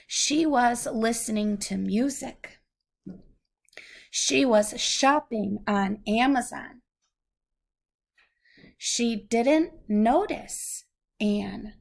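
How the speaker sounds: clear, measured storybook reading; reading to a child. Acted. Parent reads a picture book to a child, gentle storybook reading. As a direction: read it out slowly for a little listener.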